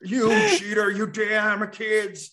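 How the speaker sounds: put-on shouting cranky old man voice; grumpy old man. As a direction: yell at the kids like a crotchety grandpa. Continuing grumpy old man bit.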